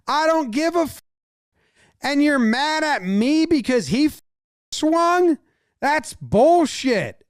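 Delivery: high, heated player yelling at the pitcher; mock-furious, high and slowed protest; mock angry yelling. Player confronting the pitcher: high angry yelling.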